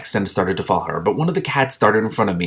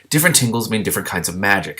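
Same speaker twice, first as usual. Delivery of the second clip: ordinary delivery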